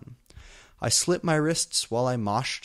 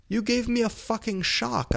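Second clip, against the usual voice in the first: much higher, startled hammed-up shout; angry shout; 12 semitones higher than usual. A narrator's reading. Narrator voicing the startled heroine's hammed-up yell. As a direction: yelp it out like you've been caught. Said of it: "I shouted angrily".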